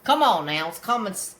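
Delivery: ordinary talk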